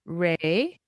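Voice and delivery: echoing syllable by syllable; staccato. Shown as a demonstration. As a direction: repeat each syllable after a pause. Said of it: "repeat after you syllable by syllable".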